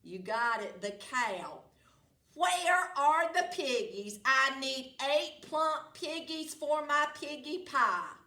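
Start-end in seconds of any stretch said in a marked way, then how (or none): 2.3-8.2 loud, high bossy witch; witch demanding. Acted. Witch demanding piggies from the cow.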